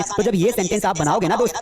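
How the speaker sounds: normal talking voice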